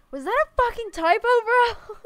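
shrieking, high and loud; angry shout. Acted. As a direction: yell it in disbelief. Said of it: "And it shows up, like".

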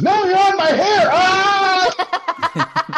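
very high, loud mock shriek; hammed-up wail of pain for a punchline; screaming in pain. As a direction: shriek it as the pinned wife, ending in a scream. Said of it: "and she's screaming".

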